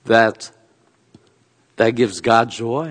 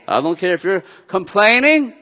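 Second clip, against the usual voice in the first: laughing through a teasing God voice; playful and amused, lines broken by laughter; God's voice, laughing; 5 semitones higher, 4 dB louder than usual. Retold or imitated. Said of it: "He says".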